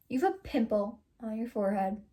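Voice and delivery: plain reading voice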